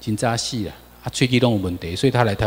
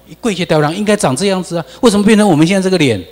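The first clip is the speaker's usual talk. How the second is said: louder, faster and higher with rising indignation; indignant outburst; 8 semitones higher, 6 dB louder, faster than usual. Real, not acted. Lecturer to audience about faces, rising indignant outburst. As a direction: demand it of the audience, fired up.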